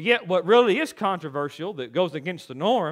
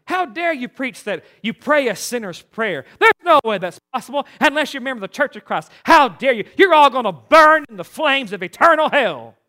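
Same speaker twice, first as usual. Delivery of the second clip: building to a shouted damnation, louder toward the end; furious caller shouting; 3 semitones higher, 6 dB louder than usual. Retold or imitated. Voicemail caller condemning the children's home, building to a shout. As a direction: hammer it home like an outraged caller condemning them.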